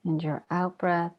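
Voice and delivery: calm, even meditation voice with long pauses; guided meditation. Real, not acted. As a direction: guide them gently inward, leaving long silences between phrases. Leads guided breathing meditation.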